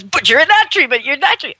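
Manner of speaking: high, insistent puzzled dog voice; the baffled dog accusing the squirrel; dog arguing with a squirrel, high and bewildered; confused dog. Retold or imitated. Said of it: "he goes".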